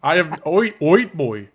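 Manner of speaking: a Canadian accent put on for the name. Shown as a demonstration. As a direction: lean on the "Oit" like a Canadian would. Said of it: "so put the accent on it".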